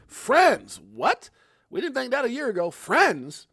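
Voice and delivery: loud, high disbelieving "Friends?"; incredulous. Real, not acted. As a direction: scoff in disbelief. Reacting to quote disbelieving.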